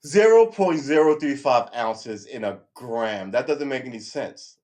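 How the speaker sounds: slow, loud incredulous repeat; loud disbelief. Real, not acted. Host challenging the phone assistant's answer, loud disbelief. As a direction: repeat the figure loudly, drawing it out in disbelief.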